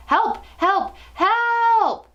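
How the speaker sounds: put-on boy screaming to anyone outside the ballroom; desperate screams. A narrator's reading. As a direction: scream toward the doors hoping someone hears. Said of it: "I began to scream".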